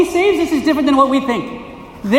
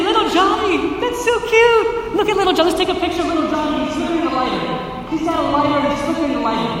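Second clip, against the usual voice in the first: high, gushing mock baby-talk praise; a parent gushing over a child with a lighter, mock adoring; doting parent; 3 semitones higher than usual. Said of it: "are you gonna say".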